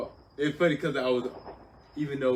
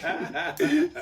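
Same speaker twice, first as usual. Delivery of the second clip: high laugh; 9 dB louder than usual. Real, not acted. Retelling punchline.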